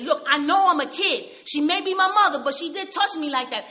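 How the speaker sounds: insist, raising the voice; insistent shout. Retold or imitated. Boy insists to the shrink that it happened, shouting.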